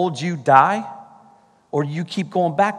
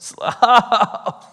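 high, pained burst of laughter; rueful laugh; 10 semitones higher, 5 dB louder than usual. Real, not acted. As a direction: laugh it off as it hits home. Laughs at the painful verse list.

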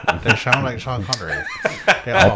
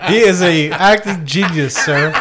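mock indignant; 5 semitones higher, 4 dB louder, much slower than usual. Mock defending Nicolas Cage.

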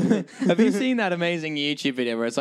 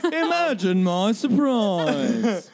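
hammed-up sarcasm; 4 semitones higher, much slower than usual. Sarcastic repeat.

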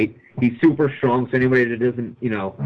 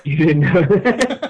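laughing echo; 6 semitones higher, 5 dB louder, much slower than usual. Pretend. Echoes the line, laughing.

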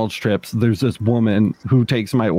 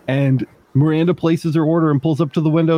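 ordinary talk

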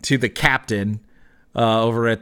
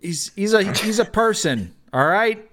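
high-pitched, flustered captain voice; offended, higher-voiced objection; indignant captain; 8 semitones higher than usual. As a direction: protest as if the question is absurd. Captain defends Kenku, in character.

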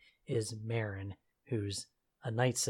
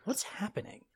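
baffled disbelief; 11 semitones higher, 5 dB louder than usual. Retold or imitated. Mock confused Halo player.

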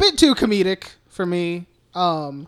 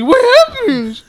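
mimicking friends; 11 dB louder, slower than usual. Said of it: "as soon as you guys".

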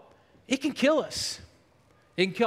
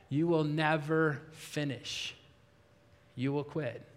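much softer and lower, slow, a heavy discouraging murmur; discouraging inner voice; 8 semitones lower, 8 dB quieter, slower than usual. Acted. Preacher voicing the inner voice that says you'll quit, low slow murmur. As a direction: let it sink in low and slow like the doubt inside. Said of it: "that's my number one voice".

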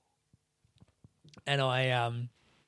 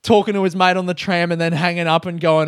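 usual speaking voice

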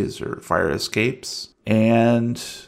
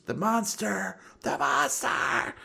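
mock distant screaming; 9 semitones higher, 9 dB quieter than usual. Man screamed as he is pulled away, high fading mock scream. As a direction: scream it small, like someone hauled off.

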